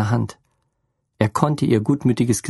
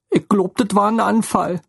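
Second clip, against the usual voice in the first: higher, tearful motherly voice; upset motherly woman; 8 semitones higher than usual. A narrator's reading. Lene breaks the news to Theo, distraught. Lene's voice again.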